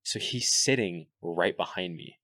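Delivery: usual speaking voice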